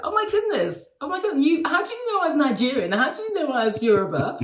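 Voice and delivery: high-pitched retold amazement, settling as it goes; re-enacted surprise, voice leaping up; astonished. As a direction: gasp out delighted disbelief at being recognised. Retold astonished reply to being recognised.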